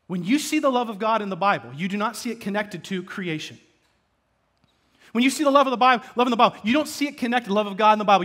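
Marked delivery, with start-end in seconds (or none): 5.1-8.2 preaching. Real, not acted. Preacher's fervent rising climax.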